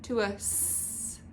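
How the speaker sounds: "s" as a long voiceless hiss, no vowel; isolated s sound. Shown as a demonstration. Teacher showing the new first sound, hissed "s". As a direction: hiss the "s" alone like air from a tyre. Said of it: "change the mm".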